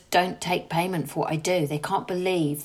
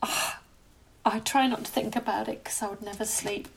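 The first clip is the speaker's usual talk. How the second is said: tearful; 7 semitones higher than usual. Real, not acted. Upset talking about suffering women.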